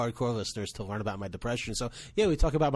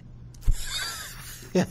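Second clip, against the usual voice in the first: high squealing laugh; real helpless laughter; burst of laughter. Real, not acted. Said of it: "I'm so sorry I'm laughing".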